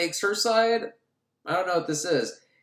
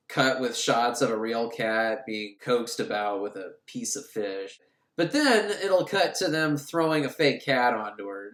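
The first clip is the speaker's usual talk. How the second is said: ordinary delivery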